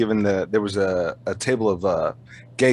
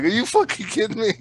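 much higher, imitating stunned disbelief; mock shocked, pitched way up; 12 semitones higher than usual. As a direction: squeak it out like he's floored. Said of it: "He was like".

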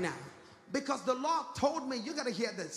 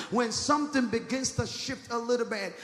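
usual speaking voice

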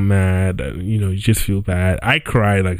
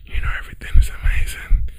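whisper; faster than usual. Real, not acted. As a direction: whisper it like a secret aside. Joking aside about the studio.

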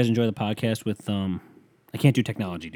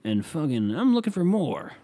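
high, eager mocking voice; a Reddit poster asking for Poland podcasts, mocking; 6 semitones higher than usual. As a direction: read it like a clueless earnest poster. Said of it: "you heard that right".

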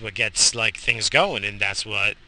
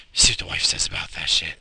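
breathy whispered aside, half to himself; 5 semitones lower, 3 dB louder, faster than usual. Real, not acted. Musing to himself about the wife, whispered. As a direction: hush it like a secret joke.

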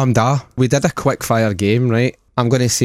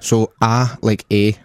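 ordinary delivery

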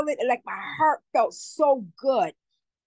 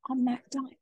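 hushed whisper of a spirit; 6 dB quieter than usual. Retold or imitated. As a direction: breathe it like a voice from beyond. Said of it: "the spirit of him telling me".

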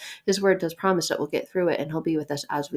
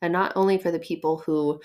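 usual speaking voice

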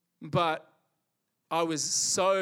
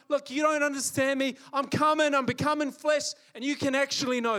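higher, louder earnest voice of God; God pleading; 6 semitones higher, 4 dB louder than usual. Retold or imitated. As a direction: urge people to finally understand. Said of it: "He's gone".

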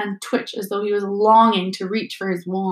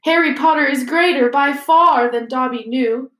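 loud, raised elf voice, a touch tearful; mild sob; 4 semitones higher, 5 dB louder than usual. A narrator's reading. Elf hugging Harry in farewell, mildly sobbing. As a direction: declare it loudly with understated tearfulness. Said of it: "he sobbed".

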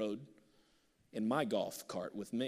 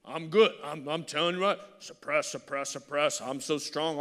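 loud, mock-tough bragging voice; preacher mocking a proud, hardened man, loud and boastful; prideful mockery; 3 semitones higher, 9 dB louder than usual. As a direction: ham up the proud man who needs no one. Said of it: "So if you're so prideful".

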